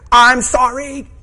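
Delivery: loud, high mock wail; hammed-up bawled apology; imitated crying outburst. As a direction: blurt it out as an exaggerated tearful cry. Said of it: "She's crying uncontrollably".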